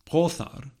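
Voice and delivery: "Prothar" sounded out with th as in thin; voiceless middle fricative. Shown as a demonstration. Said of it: "has a voiceless fricative".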